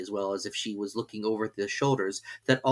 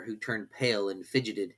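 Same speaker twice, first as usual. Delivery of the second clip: ordinary delivery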